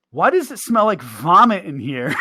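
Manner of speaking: loud, slow, laughing disgusted question; buddy's loud disgusted question while laughing; mock loud complaint. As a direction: complain loudly about the stink. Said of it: "he goes".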